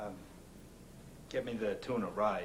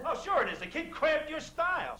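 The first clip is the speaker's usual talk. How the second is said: angry; 3 dB louder than usual. Acted. Heated argument over the kid.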